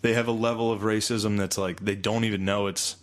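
ordinary delivery

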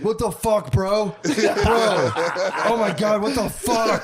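high-pitched mock outrage; mad wife. Husband retelling wife's rude awakening, mock mad. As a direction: imitate an angry, half-asleep wife. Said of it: "She was, like, mad".

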